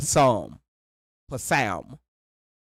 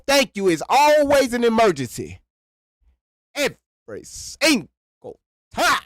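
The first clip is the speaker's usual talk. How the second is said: pitched-up shouting complaint; exasperated shout; 8 semitones higher than usual. Real, not acted. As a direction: throw your hands up at it. Ranting about constant emergencies.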